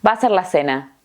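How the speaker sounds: VA A SER merged as "vaser"; quick dictation phrase, words run together; très rapide. Shown as a demonstration. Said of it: "Lo voy a decir muy rápido".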